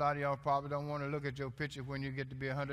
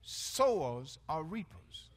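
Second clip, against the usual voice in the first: slow repeat; slower than usual. Shown as a demonstration. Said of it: "repeating the saying".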